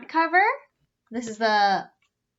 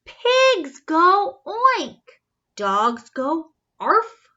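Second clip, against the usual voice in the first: slow, higher lesson voice; slow teaching; 6 semitones higher, 5 dB louder, much slower than usual. A narrator's reading. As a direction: drag each word out. Mother teaches.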